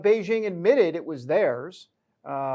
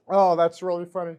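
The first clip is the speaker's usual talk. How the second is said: normal talking voice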